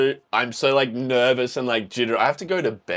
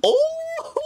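excited gasp. Real, not acted. Fan hyped as the rival match begins, excited cry. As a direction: burst out with delight.

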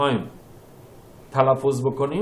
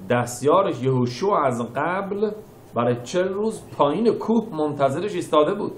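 usual speaking voice

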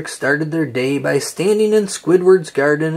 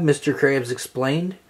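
usual speaking voice

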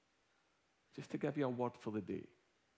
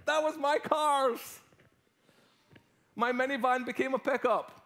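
loud, high, animated retelling; excited storytelling; 11 semitones higher, 7 dB louder than usual. Acted. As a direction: tell it big to the whole room. Excited storytelling, much louder.

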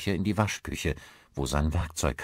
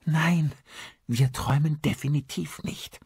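high-pitched, upset woman insisting; distraught insistence; 9 semitones higher than usual. A narrator's reading. Distraught wife answers her husband. (aufgewühlt, hoch beharrend)

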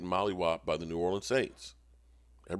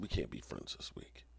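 hushed quote; 10 semitones lower, 15 dB quieter than usual. Retold or imitated. Quoting a message in hushed voice.

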